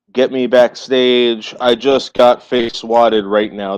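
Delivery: slow emphatic read. Real, not acted. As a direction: drag out the words. Reading chat slowly.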